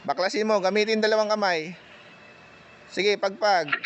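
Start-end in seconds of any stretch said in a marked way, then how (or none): none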